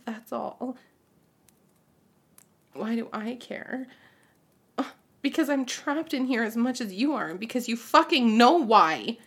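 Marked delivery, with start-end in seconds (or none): 5.2-9.2 much louder, angry shout building; furious outburst. Acted. Mother yelling at her son in anger. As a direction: explode at him, louder on the curse.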